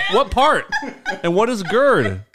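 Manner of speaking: ordinary talk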